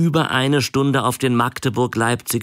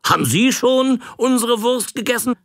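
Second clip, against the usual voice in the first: put-on eager old man asking; 14 semitones higher than usual. Old man pushing his sausage on the traveller, higher and eager. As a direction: lean in and press him. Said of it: "fragte er weiter".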